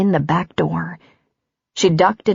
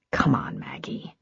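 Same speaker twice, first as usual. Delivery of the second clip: soft plea; 4 semitones lower, 10 dB quieter than usual. Acted. Andrew pleading softly.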